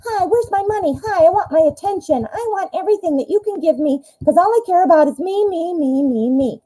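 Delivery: mock the ex as a greedy me-me-me; Miriam, greedy. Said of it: "here comes Miriam".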